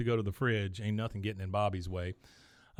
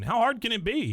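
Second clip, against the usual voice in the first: high, louder imitation of disbelief; mock protesting; 12 semitones higher, 5 dB louder than usual. Bobby challenging Dad about capers, mock incredulous protest. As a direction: protest with pitch jumping up.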